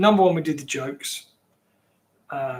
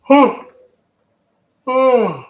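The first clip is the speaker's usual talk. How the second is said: surprised oh; 9 semitones higher, 10 dB louder than usual. Real, not acted. Sudden reaction at the screen.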